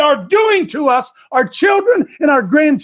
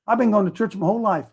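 mock churchgoer; 8 semitones lower, 8 dB quieter, faster than usual. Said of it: "you say".

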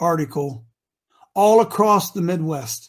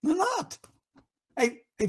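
normal talking voice

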